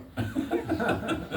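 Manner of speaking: quiet breathy chuckle; soft airy laugh. Real, not acted. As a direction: laugh under your breath. Laughing at own mockery.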